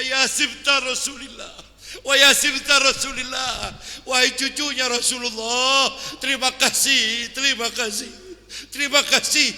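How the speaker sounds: slow, loud, fervent gratitude; overwhelmed thanks. Acted. Sheikh embracing the Imam, loud slow thanks. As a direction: overflow with thanks, drawn out.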